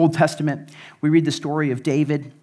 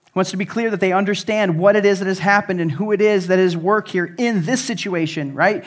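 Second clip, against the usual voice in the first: normal talking voice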